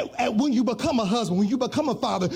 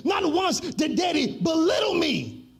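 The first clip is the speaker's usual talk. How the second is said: rising, chanted sermon cadence; preaching; 5 semitones higher than usual. Acted. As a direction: preach it, building with each line.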